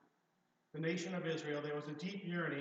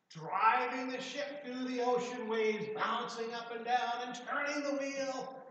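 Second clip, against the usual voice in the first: excited storytelling; 7 semitones higher, 6 dB louder than usual. A narrator's reading. Narrating child's game with excitement.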